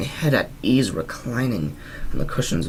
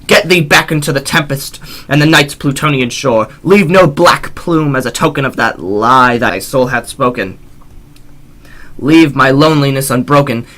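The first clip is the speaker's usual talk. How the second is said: loud, higher furious command; furious banishing; 6 semitones higher, 9 dB louder than usual. Acted. Narrator ordering the raven from his door, loud furious command. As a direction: drive the bird out with rage. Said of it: "I shrieked, upstarting".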